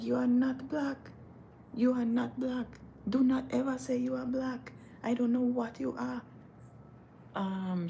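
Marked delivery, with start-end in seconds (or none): 0.0-6.2 high, insistent scolding; imitated African lady. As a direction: scold in a high, measured put-on voice. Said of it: "This African lady".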